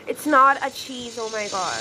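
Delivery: ordinary talk, nothing marked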